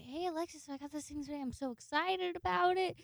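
high, bubbly mock excitement. Teasing roommate Alexis with a gush of mock excitement. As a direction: gush like it's a gift.

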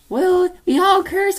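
put-on high, slow old lady voice; grandma shrugging. Grandma shrugging off cursing to grandson, high and slow. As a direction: say it like a casual grandma. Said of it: "my grand's like".

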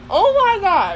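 high, breathless shock; startled oh my god. Real, not acted. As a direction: react as if you saw something.

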